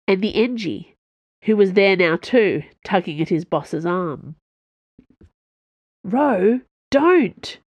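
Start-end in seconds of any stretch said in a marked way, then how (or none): 6.0-7.6 high, urgent plea; pitched-up, insistent pleading. A narrator's reading. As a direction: beg them to stop, voice rising. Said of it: "he said in a pleading voice".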